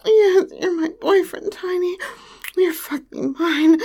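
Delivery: breathless escalating moans; moaning, building. Acted. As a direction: let the moans build and break. Building toward climax, moaning.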